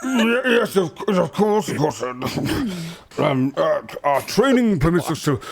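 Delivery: high, flustered stammering general; nervous general. Acted. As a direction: stumble over words, nervously. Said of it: "General goes".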